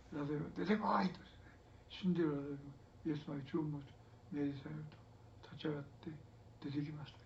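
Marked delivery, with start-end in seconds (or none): 0.2-1.1 a loud, commanding call. Acted. Preacher re-enacting Jesus ordering Lazarus out, loud. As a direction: bark the order into the tomb.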